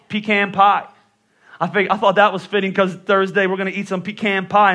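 ordinary talk

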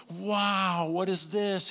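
put-on high, loud childlike amazement; wide-eyed like a grown man turned child; elf-like wonder. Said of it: "And he goes".

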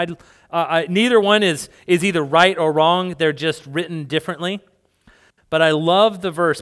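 ordinary talk

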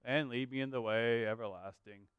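a dull, limp version of the verse; flat, deflated reading. Shown as a demonstration. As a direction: read it like it ends in a full stop. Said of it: "So it's not like".

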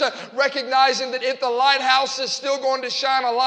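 loud, driving Pentecostal sermon delivery; rally the church with full-voiced conviction; impassioned preaching. Real, not acted. Preaches to congregation.

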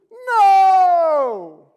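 long, slow, high, loud no; preacher hammering home the no; drawn-out shout. Real, not acted. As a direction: stretch it out and shout it. Preacher's emphatic denial.

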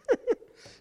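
a high, loud burst of delighted laughter. Retold or imitated. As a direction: laugh out loud with sudden joy. Excited reaction to big offer.

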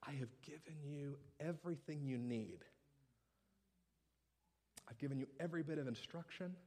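patient God. Acted. God answering a frustrated child, soft and patient. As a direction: speak softly like a patient father. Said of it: "all the while he's saying".

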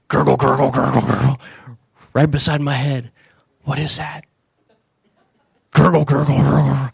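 low rumbling gurgle imitation; mock gurgle. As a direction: imitate a growling stomach. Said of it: "I hear the sound".

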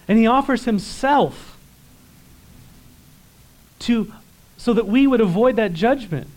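fervent preaching. Acted. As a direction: preach it out with fire. Preaching at full force.

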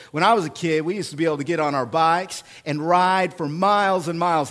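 normal talking voice

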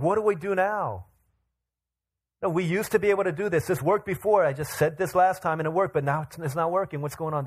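high, loud, hurried frustration; pleading with rising exasperation; frustrated disciples. Retold or imitated. Disciples baffled that their power failed, frustrated. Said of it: "They're frustrated".

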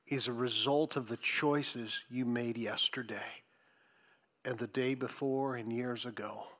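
quiet, earnest counsel to friends; solemn warning. Real, not acted. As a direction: drop soft and low to warn them.

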